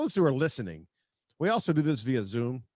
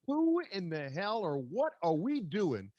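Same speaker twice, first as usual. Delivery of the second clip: higher and slower, a mock outraged fan; imitating furious fans; 6 semitones higher, 3 dB quieter, slower than usual. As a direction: play a fed-up Jets fan asking what they're doing. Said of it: "and they're like".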